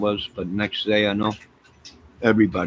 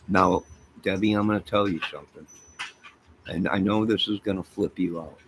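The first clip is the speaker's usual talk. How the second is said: ordinary delivery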